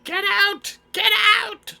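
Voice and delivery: repeated high-pitched loud yells; shouting. Acted. Gnome shopkeeper ordering intruders out, shouting. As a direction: scream them out of the shop. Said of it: "He just keeps shouting".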